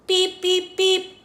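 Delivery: high beeping horn; truck beep. A narrator's reading. Little Blue welcoming the animals, high beeps.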